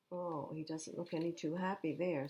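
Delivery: plain reading voice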